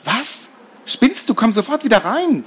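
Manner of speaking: put-on high, sharp scolding girl; shocked scolding. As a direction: snap at him in disbelief. Laura scolds Robert.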